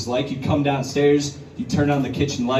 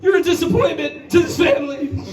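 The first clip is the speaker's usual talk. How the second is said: mock parent shout; 14 semitones higher than usual. Son shouting at his mom like a parent, hammed-up. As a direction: yell it like a disappointed parent. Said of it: "I was like".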